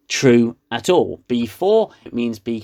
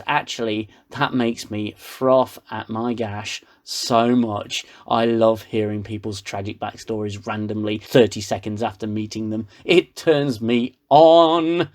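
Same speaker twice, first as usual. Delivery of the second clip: mock sultry Soji; 5 semitones lower than usual. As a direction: drag it out in a mock come-hither voice. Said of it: "And she goes".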